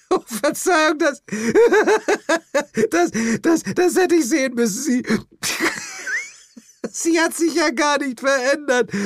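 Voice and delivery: high, loud, words broken by laughter; doubled over laughing. Acted. Jane doubles over at the toad, words broken by laughter. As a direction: laugh through every word. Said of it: "Jane krümmte sich vor Lachen".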